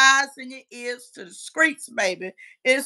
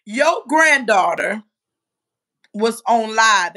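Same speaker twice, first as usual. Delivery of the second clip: slow emphatic reveal; 3 semitones lower, 8 dB louder, slower than usual. Real, not acted. Dramatic reveal to viewer.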